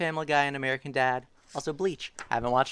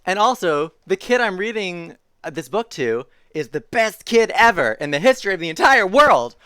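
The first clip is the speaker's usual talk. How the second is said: loud, higher-pitched gushing proclamation; animated story reading; 7 semitones higher, 10 dB louder than usual. A narrator's reading. Reader praises the kid listening, loud exuberant read-aloud. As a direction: build to a big final word.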